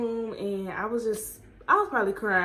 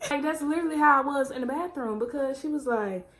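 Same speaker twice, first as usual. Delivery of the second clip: ordinary delivery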